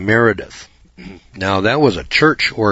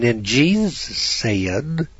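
mock thin pulpit voice, higher and very slow; squeaky little preacher; 4 semitones higher, much slower than usual. Mocking a weak pulpit preacher, squeaky and slow. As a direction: drag it out like a timid preacher. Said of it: "you hear some little squeaky voice".

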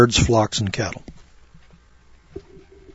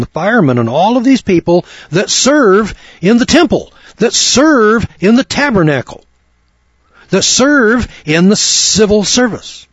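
hammed-up protest, rising pitch; mock indignant question; 6 semitones higher than usual. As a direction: play the baffled objector, building up. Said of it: "we would say".

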